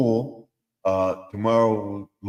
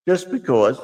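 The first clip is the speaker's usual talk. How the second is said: stubborn reply; 5 semitones higher, 5 dB louder than usual. Acted.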